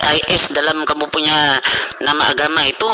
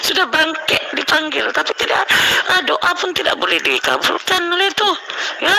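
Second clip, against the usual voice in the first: mocking high voice; 9 semitones higher than usual. Real, not acted. Mocking the prayers.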